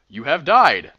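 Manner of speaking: loud doom-laden announcer; game over boom. Acted.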